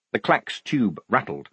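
ordinary delivery

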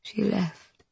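soft, hollow, grieving; hushed grief. Acted. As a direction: let it fall away quietly. Grieving Queen repeats herself.

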